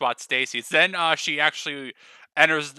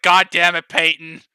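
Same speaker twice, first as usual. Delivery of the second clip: loud, fed-up outburst; exasperated shout; 10 dB louder than usual. Acted. Ebony loudly exasperated at Peyton's greeting. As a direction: blurt it out, really fed up. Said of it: "Ebony's like".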